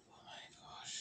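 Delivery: breathless shocked whisper; whispered oh my gosh. Acted. Vlogger stunned by how much he spends, whispering. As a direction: whisper it under your breath.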